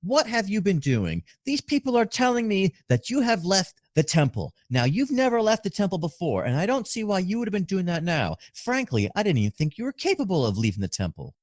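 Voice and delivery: high, stern telling-off; scolding mother voice. Acted. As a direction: wag your finger and scold him. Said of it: "scalding him".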